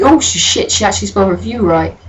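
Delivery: ordinary talk, nothing marked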